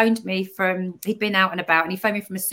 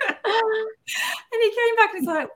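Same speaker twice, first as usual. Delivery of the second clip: high, slow, laughing while crying; real laughing tears; 12 semitones higher, slower than usual. Real, not acted. Moved guest talking to hosts, really laughing through tears. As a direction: let the tears and laughter spill together. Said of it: "Sorry, I don't know where that came from".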